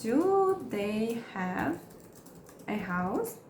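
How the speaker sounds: very slow, chunked; slow teaching read; slow model question. Shown as a demonstration. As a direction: drag it out for learners. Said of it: "Vamos criar mais exemplos".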